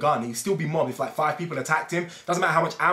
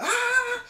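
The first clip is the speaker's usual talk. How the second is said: very high cartoon yelp; mock death scream. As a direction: yelp as the soldier gets shot again. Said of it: "he's like".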